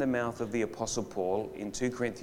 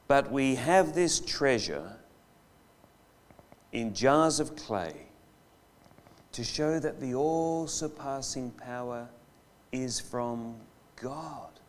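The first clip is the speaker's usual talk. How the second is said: slow scripture reading; slower than usual. Acted. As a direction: read it slowly, letting it fall away.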